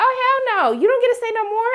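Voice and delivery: very high, indignant exclamation; outraged refusal. Retold or imitated. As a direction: shoot it down in high-pitched disbelief. Acts her outraged reaction.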